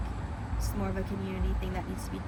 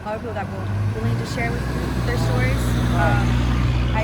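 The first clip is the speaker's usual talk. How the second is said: getting louder over the crowd; raised voice; 2 semitones higher, 10 dB louder than usual. Real, not acted. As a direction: push the voice up over noise. Protester raising voice.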